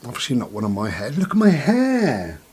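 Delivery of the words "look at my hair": high, drawn-out look at my hair; mock dismay. Real, not acted. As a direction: put on a high comic whine. Showing off his grown hair.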